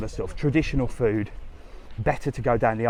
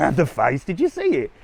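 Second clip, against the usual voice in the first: jumps much higher on "did you see it?"; disbelieving laugh-rant; 4 semitones higher than usual. Real, not acted. Vlogger to viewers still amazed by the waiter, high-pitched disbelief. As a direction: still amazed at the sour look. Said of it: "Little rant from me".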